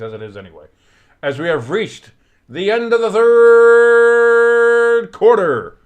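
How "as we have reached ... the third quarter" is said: drawn-out slow, loud, high announcer call; mock announcer boom. Streamer closing the quarter for viewers, hammed-up announcer boom. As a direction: stretch it like a big stadium announcer.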